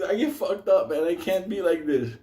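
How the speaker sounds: usual speaking voice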